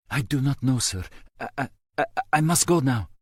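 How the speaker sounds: put-on frightened boy stammering, needing to hang up; panicked, accented. A narrator's reading. Frightened caller hurriedly hangs up.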